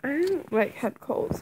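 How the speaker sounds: ordinary delivery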